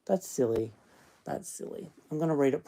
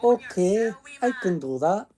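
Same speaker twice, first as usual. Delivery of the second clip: higher-pitched stab at a Scottish accent; attempted Scottish accent; 6 semitones higher, 3 dB louder than usual. Shown as a demonstration. Reader testing the accent before starting, attempted Scottish accent. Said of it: "This is going to be hopeless".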